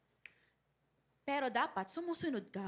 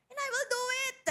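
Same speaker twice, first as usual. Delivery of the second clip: tearful, much higher quote; choked up; 10 semitones higher than usual. Real, not acted. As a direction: voice breaking high with emotion. Preacher moved while quoting promise.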